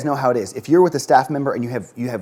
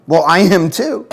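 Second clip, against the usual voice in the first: slow, louder, indignant agreement; slow, louder mock anger to side with an angry mom; mock mad; 5 semitones higher, 9 dB louder, slower than usual. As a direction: drag it out in mock anger to match the parent. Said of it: "If they're mad, I'm mad".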